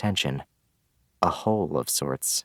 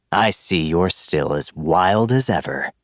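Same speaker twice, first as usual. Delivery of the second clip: slow, sneering villain; 4 dB louder than usual. Acted. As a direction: taunt her slowly, sneering. Villain taunts dragon girl.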